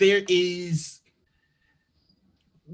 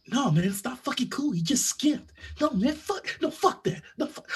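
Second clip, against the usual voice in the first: muttered complaints, a touch higher and softer; grumbled complaint; 5 semitones higher, 6 dB quieter than usual. Acted. Annoyed customer grumbling in the queue, muttered. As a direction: grumble it under your breath to the others in line. Bystander grumbling behind barista's back.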